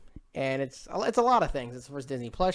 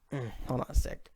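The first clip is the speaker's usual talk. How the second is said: talking round something in the mouth; mouth full; 8 dB quieter than usual. Real, not acted. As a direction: speak with something in your mouth. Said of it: "I got, like, a thing in my mouth".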